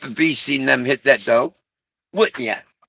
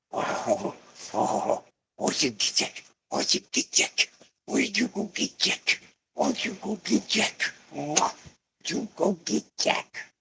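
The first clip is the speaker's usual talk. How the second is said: whispered dog coaxing; 4 semitones higher, 11 dB quieter than usual. Real, not acted.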